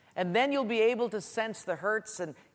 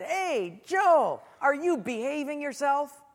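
playful, higher-pitched call; joking greeting; 7 semitones higher, 4 dB louder, slower than usual. Retold or imitated. Preacher jokingly calling out to an acquaintance, playful. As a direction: tease him with a raised voice. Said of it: "kind of being funny".

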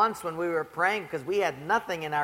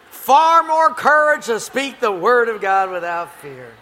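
louder proclamation; forceful preaching; 3 semitones higher, 12 dB louder than usual. Real, not acted. Preacher declaring to the congregation, loud and strong. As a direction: drive the point home.

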